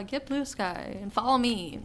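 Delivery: ordinary talk, nothing marked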